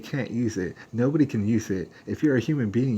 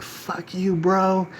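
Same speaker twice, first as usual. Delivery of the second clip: high, angry mock yell at the speaker; imitating a furious guy snapping back; angry retort; 9 semitones higher, much slower than usual. Angry guy telling the speaker off, high mock yell. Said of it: "I shouldn't have cussed".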